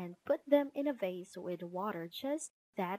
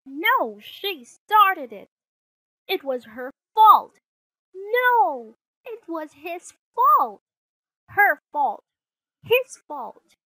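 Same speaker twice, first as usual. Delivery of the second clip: loud, high back-and-forth squabble; high-pitched yelled accusations; bickering children; 8 semitones higher, 15 dB louder than usual. Acted. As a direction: shout the blame at each other like quarrelling kids. Children's quarrel opens the story.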